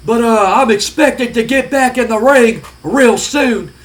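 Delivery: a loose, lightly done impression of a tough-guy wrestler; Stone Cold Steve Austin. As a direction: play the brash brawler explaining his injury. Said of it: "Stone Cold's like".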